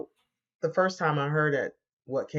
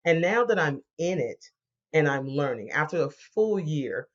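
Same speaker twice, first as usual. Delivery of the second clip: ordinary talk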